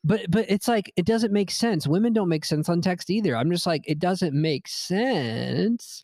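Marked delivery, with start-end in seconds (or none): 3.9-6.0 very slow, words stretched out; drawn-out mock protest. Arguing that women's texts baffle him, slow mock protest. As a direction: drag out "sense" with a rising end. Typing out the sentence, exaggerated.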